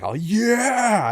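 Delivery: high, drawn-out excited yell; mock hyped cheer. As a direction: imitate a band all fired up. Imitating a hyped band starting.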